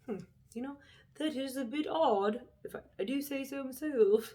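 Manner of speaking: mock prim bystander. Mocking an oblivious viewer.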